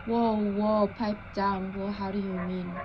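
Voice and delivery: ordinary talk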